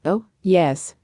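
mildly offhand storybook reply; indifferent. A narrator's reading. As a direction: say it with little concern.

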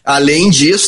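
normal talking voice

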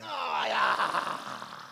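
very low, loud shouted cry. Acted. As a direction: let out a booming cry. Shouted exclamation in call-response.

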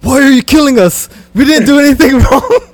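high, loud, laughing whiny plea; imitating Endgame character. Friend retelling an Endgame scene with a mocking whine. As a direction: plead through laughter, mocking a movie character. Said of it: "He's like".

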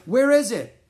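very loud, high question; frantic cry. A narrator's reading. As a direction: demand it in rising panic. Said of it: "cried Gunner".

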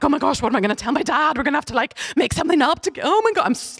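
high, fast panicky babble; retold panic. Teen in the taxi panicking about her dad, high and fast. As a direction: rush it like you're freaking out. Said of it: "I'm like ... freaking out 'cause I'm scared".

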